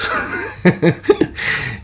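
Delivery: breathy amused laugh; chuckle. Real, not acted. Laughs at end of story.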